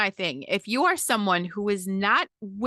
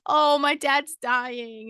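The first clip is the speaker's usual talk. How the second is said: mock self-pity; 5 semitones higher, 3 dB louder, much slower than usual. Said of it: "'Cause that's about you".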